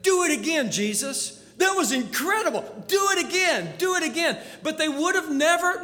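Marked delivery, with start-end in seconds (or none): 0.0-4.4 eager, raised cries for more; excited begging. Acted. Disciples urging Jesus to repeat the miracle, excited. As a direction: beg like thrilled children.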